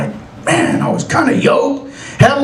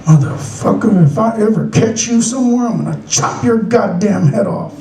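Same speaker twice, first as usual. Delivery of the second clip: low, menacing father-in-law; growing lower as the threat lands; acted fatherly menace; threatening father-in-law; 4 semitones lower than usual. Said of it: "And in his head".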